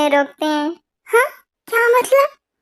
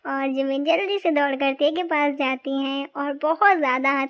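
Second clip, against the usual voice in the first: softer narrator voice, reading the story aloud; story narration; 2 semitones lower, 4 dB quieter than usual. A narrator's reading. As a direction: step out of the scene and narrate calmly. Narrator tells story between voices. (धीमी आवाज़ में कहानी सुनाना)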